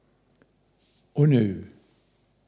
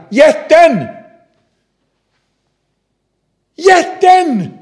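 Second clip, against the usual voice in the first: put-on very high, loud child's shout; little girl screaming; 9 dB louder, much slower than usual. Little girl screaming for the stone lifter, very high and loud. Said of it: "skriker lillflickan".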